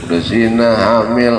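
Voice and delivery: ordinary delivery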